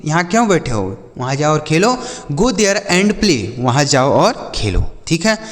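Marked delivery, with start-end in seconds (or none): 2.3-3.4 each word said slowly and apart; slow clear example. Shown as a demonstration. Teacher reading an example sentence slowly to children. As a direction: spell the sentence out slowly for the kids. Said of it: "जैसे".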